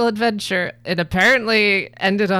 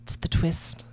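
whisper; 5 dB quieter, slower than usual. Real, not acted. Conspiratorial reveal to cohosts.

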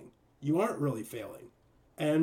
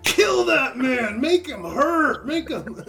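much higher, louder and slow hammed-up rage; slow, high, loud comic shouting; mock furious shout; 11 semitones higher, 7 dB louder, slower than usual. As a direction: yell for blood like a cartoon zealot. Acting John demanding vengeance.

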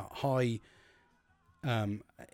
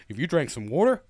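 put-on loud, higher-pitched American accent; 4 semitones higher, 8 dB louder than usual. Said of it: "I went into an American accent then".

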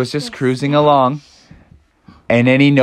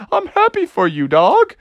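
playful otter voice; 14 semitones higher than usual. Acted. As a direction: tease him warmly as a cheeky otter. Otters popping up saying.

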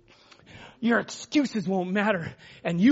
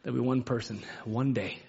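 much softer, lower preaching over music; soft altar call; 12 semitones lower, 9 dB quieter than usual. Acted. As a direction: ease the room into quiet prayer.

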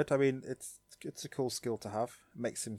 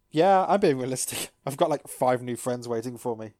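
cheery Gomamon; 8 dB louder than usual. Retold or imitated. Gomamon boasting of new friends, loud cheery voice. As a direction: brush it off with a bouncy laugh. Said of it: "Gomamon's just like".

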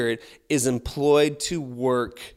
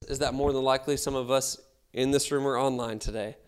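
ordinary delivery

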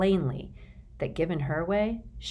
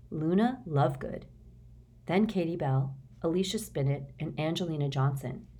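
usual speaking voice

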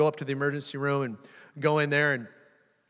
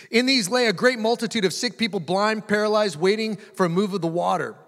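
measured, raised Bible reading; scripture reading; 4 semitones higher, 4 dB louder than usual. Acted. Preacher reading John five aloud to the church. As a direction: read the passage out to the congregation.

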